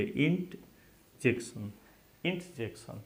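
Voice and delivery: इंटजेक्शन said slowly with no r; silent r. Shown as a demonstration. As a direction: draw out इंटजेक्शन without the r. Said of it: "तो इसको नहीं बोलेंगे". (इंटजेक्शन, आर को मत बोलें, धीरे से)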